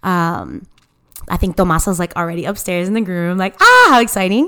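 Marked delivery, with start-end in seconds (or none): 3.6-4.5 higher, louder burst of delight; acted excitement. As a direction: exclaim it like an eager onlooker. Voicing excited reaction upstairs.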